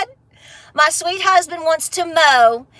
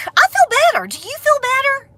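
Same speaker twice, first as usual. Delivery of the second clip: playful high voice; 10 semitones higher than usual. Pretend.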